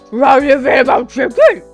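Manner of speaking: loud shout from the next room; big, loud character call. Acted. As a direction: holler the question across the house. Character calling from kitchen.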